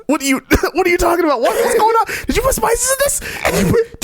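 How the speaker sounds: high, fast, squeaky panic; panicking boyfriend. Retold or imitated. Cohost voicing the boyfriend realizing he's poisoned, panicked squeak. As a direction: blurt it out in alarm. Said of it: "boyfriend panicking".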